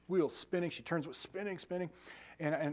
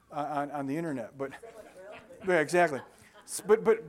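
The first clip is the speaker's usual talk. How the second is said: ordinary talk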